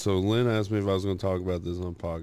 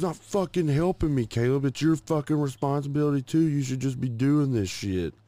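imitated scolding, high and heated; retold angry yelling; 7 semitones higher, 3 dB louder than usual. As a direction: rant as his fed-up wife, pitched up. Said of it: "she was yelling at me".

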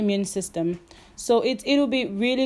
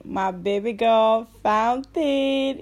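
ordinary talk, nothing marked

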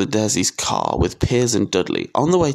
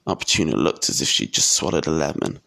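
plain reading voice

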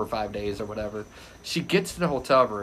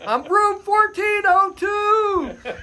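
slow, high, loud call down to the street; drawn-out retold shout; yelling; 5 dB louder, much slower than usual. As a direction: holler each word so it carries. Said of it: "I have to yell".

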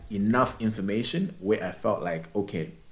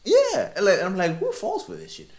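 laughing; 13 semitones higher, 6 dB louder than usual. Real, not acted. Laughing disbelief at the scam.

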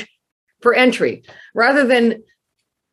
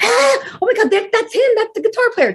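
high, squealing fangirl excitement, much higher; starstruck squeal; 13 semitones higher than usual. Retold or imitated. Teen girl spotting guitarist in parking lot, squealing high. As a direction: shriek like a fan spotting her idol. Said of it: "I was like".

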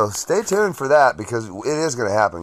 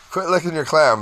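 sharp raised command; retold scolding; 6 semitones higher, 5 dB louder than usual. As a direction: scold the dog again, louder. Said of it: "The dog looks over guiltily".

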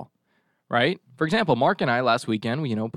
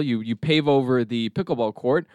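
usual speaking voice

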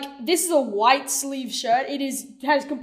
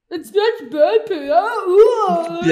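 disgusted cry; 6 semitones higher, 8 dB louder, much slower than usual. Retold or imitated. Retelling Hammy's disgusted reaction.

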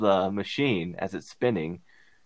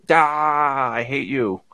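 louder mock old-man outburst; old man yelling; 5 semitones higher, 9 dB louder, much slower than usual. Imitating the old man yelling at his machine before trashing it, loud mock outburst. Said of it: "yelling at it".

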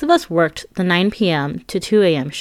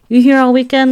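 Luciana asking; 7 semitones higher, 8 dB louder than usual. Retold or imitated. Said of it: "Luciana asked me one Friday".

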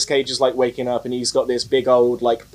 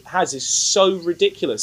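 ordinary talk, nothing marked

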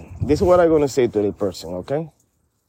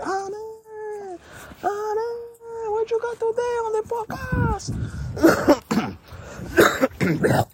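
listeners calling out; 3 dB quieter, faster than usual. Retold or imitated. Listeners calling for the podcast host, high mock calling voice. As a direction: call out in a high mock fan voice. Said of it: "they calling for you".

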